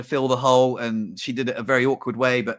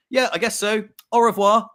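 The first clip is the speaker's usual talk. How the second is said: an offhand, breezy brush-off, raised a little; cold dismissal; 6 semitones higher, 3 dB louder than usual. Retold or imitated. Landlady brushes off her tenant with a cool, careless goodbye. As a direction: toss off a careless au revoir. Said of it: "That was cold".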